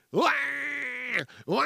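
short mock baby cries; imitated baby wail. Host mimics a baby crying in a bar, mock wail.

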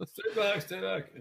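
high, laughing mock panic; mock scared. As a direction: squeal it out, laughing. Mock fear of crown.

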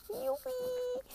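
high, gleeful cheer; excited cheer. Real, not acted. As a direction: squeal with joy. Cheers about the cleaning lady.

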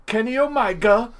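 high, loud mock British delivery; KENNY OMEGA called out high with a put-on British accent; William Regal. Showing friends a British wrestler's delivery, loud impression. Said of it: "that's how Regal would say".

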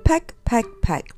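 staccato pecks, starting high; pecking sounds. A narrator's reading. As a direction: imitate a bird pecking at crust. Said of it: "The blackbird began pecking again".

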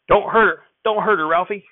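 high, loud worried plea; anxious call. Acted. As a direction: cry out for the calf's sake. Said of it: "Grandfather was saying".

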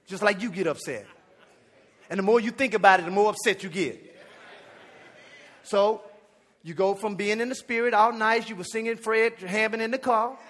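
ordinary delivery

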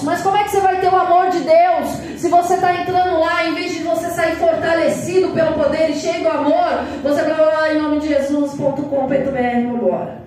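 ordinary talk

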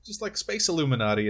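put-on retelling it in an Australian accent; imitated Australian accent. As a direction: put on a light Aussie accent. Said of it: "he does an Australian guy".

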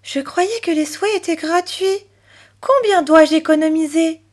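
put-on high-pitched little girl's questioning; astonished child. A narrator's reading. Chloé questioning the pig about wishes, astonished. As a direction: ask it high, taken aback. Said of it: "s'étonna Chloé".

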